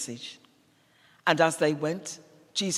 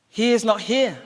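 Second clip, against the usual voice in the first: higher, louder announcement; angel's proclamation; 5 semitones higher, 6 dB louder than usual. Acted. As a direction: proclaim it high and strong. Angel's proclamation in narrated Easter story.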